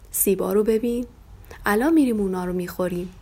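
plain reading voice

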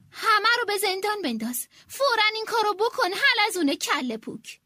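angry command. Acted. Queen yelling orders at the snail secretary. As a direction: rush the furious command.

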